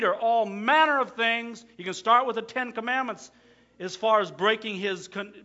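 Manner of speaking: loud, high preaching; full-force preaching. Acted. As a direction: drive the point home.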